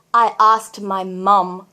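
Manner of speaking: slow, low emphatic repeat; serious. Real, not acted. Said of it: "I'm serious".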